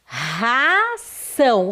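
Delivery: "Ração" drawn out very slowly; slow model reading of "Ração" for pupils; syllable-by-syllable reading. Shown as a demonstration. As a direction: stretch both syllables of "Ração". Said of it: "Fale de novo".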